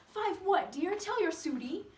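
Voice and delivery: coaxing Sue. Acted. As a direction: coax the patient with a lifted voice.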